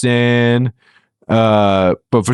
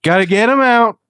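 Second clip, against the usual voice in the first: mock president voice; 7 semitones higher than usual. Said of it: "And he's like".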